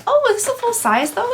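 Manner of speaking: high, bright surprised "oh"; delighted at the full size; pleasantly surprised. Real, not acted.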